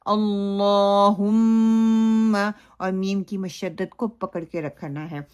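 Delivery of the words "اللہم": اللہم very slow with thick lam and long-held meem; chanted "Allohummma" on a sustained note; heavy, held recitation. Shown as a demonstration. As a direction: hold the doubled m in اللہم. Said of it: "تو ہم نے کیا کرنا ہے".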